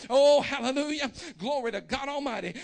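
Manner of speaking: loud fervent hallelujah; ecstatic praise shout. Real, not acted. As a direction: cry out the praise with all your breath. Preacher's ecstatic exclamation.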